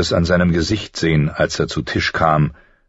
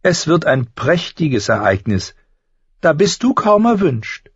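very high, haughty sneer; snooty jab; 11 semitones higher than usual. A narrator's reading. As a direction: look down on her sister. Sansa jabs at Arya.

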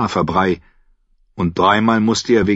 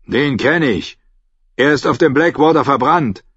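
higher, louder, dismissive guard voice; gruff guard; 6 semitones higher, 3 dB louder than usual. Acted. Guard dismisses the knight at the gate. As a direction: brush off the drunk.

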